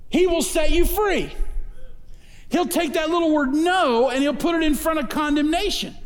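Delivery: preaching at full force. Acted. Pastor rising to the congregation about freedom, preaching full force.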